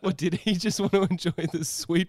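giggling at his own question; laughing. Real, not acted. Musing aloud to his co-host while laughing.

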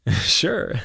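short giggle into a high-pitched word; giggly girl's quick agreement; giggled. A narrator's reading. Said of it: "looked at each other and giggled".

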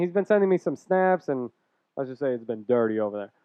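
ordinary talk, nothing marked

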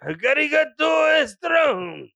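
slow, loud, high drawn-out yell; mock dub shout. Mocking a Japanese cartoon dub, slow loud shout. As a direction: stretch it into a hammed-up battle cry.

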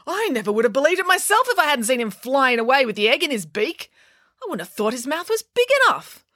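high, incredulous mum voice; amazed exclaiming. A narrator's reading. Mum describing the raven's flight to Tammy, amazed exclaiming.

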